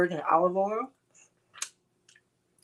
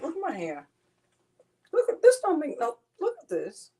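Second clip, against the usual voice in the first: excited squeal; 9 semitones higher than usual. Real, not acted. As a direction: squeal at the mess. High excited exclaim.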